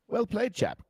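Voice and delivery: high, posh-ish mock British accent. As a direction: ham up a British gent. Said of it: "as the Brits would say".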